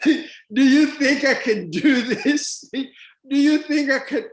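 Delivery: drawn-out question through laughter; laughing mockery. Mocking people who ask him this, laughing. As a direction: repeat it slowly, laughing at the absurdity.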